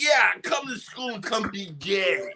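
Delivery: slow, sing-song teacher impression; mock teacher voice. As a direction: drawl it like a preachy teacher. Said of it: "they're like".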